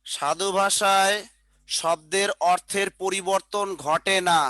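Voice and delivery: slow, word by word for note-taking; slow dictation. Shown as a demonstration. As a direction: dictate it slowly so they can write. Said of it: "আর ওই পাশে চলিত রীতিতে লেখেন".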